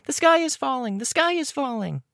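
very high-pitched mock alarm; playful high character voice. A narrator's reading. Playing on the name Chicken Little, very high-pitched. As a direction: squeak it out far above your own voice.

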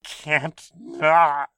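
strained through gritted teeth; biting down on pain. Acted. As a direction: force it out through clenched teeth. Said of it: "Gamble muttered".

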